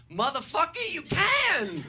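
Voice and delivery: high, loud comeback; defiant shout. Acted. Comedian answering online haters, defiant shout. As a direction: yell it triumphantly at the doubters.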